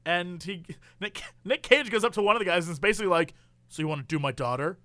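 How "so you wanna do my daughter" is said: low, quick aside in a lightly acted father's voice; confiding movie dad. Father asks one of the guys, lightly acted low and quick. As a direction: lightly put on a father sounding someone out.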